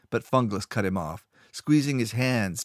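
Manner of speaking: usual speaking voice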